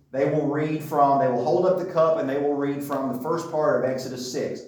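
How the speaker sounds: normal talking voice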